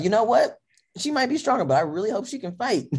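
high-pitched playful warning; mock challenge. Girlfriend warning off an imagined rival, playful challenge. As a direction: warn off a rival, high and half-joking.

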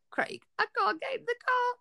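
high, soft mock whimper; retold helpless plea. Labouring wife tells husband she can't move, imitated whimpering plea. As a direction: plead squeakily, as if too far gone to move.

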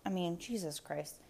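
usual speaking voice

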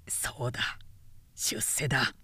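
soft low whisper to himself; hushed low self-talk; murmured resolve. Acted. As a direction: breathe it out as a private vow.

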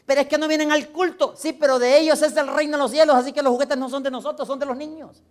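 high, loud back-and-forth protest; heated argument. Acted. Pastor and daughter disputing who gets the toys, heated argument. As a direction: fire each objection and rebuttal out forcefully.

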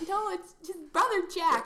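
lightly voiced high correction; mimicking. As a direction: pipe up high to set them straight. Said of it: "It's like".

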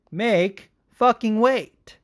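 very slow, high-pitched emphasis; exasperated insistence. Real, not acted. Podcaster who can't belabor this point anymore, exasperated. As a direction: spell it out slowly, fed up. Sums up his exasperated rant.

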